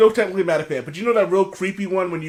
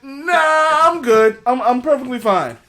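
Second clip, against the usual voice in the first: nervous refusal; 8 semitones higher, 7 dB louder, slower than usual. Retold or imitated. Retold refusal, raised voice.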